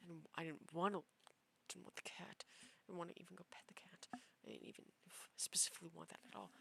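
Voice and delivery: soft, unvoiced muttered whisper; whispered aside. Real, not acted. Telling listeners she never wanted the cat, whispered aside. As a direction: drop to a whisper as a private aside.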